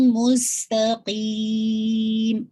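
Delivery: مستقیم very slow, س whistling, nasal only at the end; whistled sin, nasal end. Shown as a demonstration. Teacher showing the student a slow مستقیم. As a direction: stretch مستقیم, hiss the س, nasalise the final م. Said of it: "make sure کیجیے گا مس".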